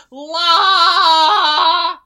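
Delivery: short loud sung "La!". Shown as a demonstration. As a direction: belt out a quick marker note. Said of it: "I would make a loud, loud noise".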